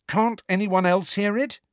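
high, insistent question; urgent, incredulous demand. A narrator's reading. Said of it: "Harry demanded".

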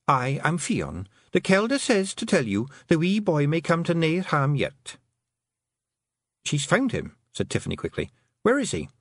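high Scottish pixie voice, formal; a tiny Feegle woman, polite and earnest; small pixie woman. Acted. Fionn the pixie speaks.

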